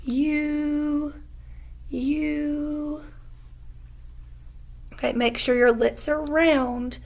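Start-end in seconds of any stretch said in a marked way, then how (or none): 0.0-3.0 steady held "you"; long U demo. Shown as a demonstration. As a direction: model a rounded "you". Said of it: "make your mouth look like mine".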